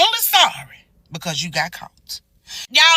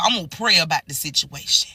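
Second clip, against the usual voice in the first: ordinary talk, nothing marked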